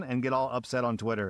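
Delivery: ordinary talk, nothing marked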